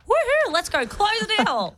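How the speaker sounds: high, bright cheer before the meeting; excited whoop. Retold or imitated. Woman psyching herself up at the door, excited. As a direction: hype yourself up to close the deal. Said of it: "I'm like".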